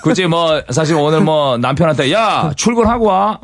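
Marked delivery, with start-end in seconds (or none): 2.1-3.4 slow, high, bossy order; bossy to husband. Retold or imitated. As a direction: order him off to work, slowly. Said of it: "이런 식으로 하지 마시고".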